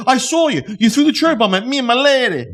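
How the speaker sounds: high-pitched irate husband impression; mock screaming accusation. Druggy husband accuses pizza guy, mock angry scream. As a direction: imitate an accuser yelling, voice pushed up high. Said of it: "And they're screaming".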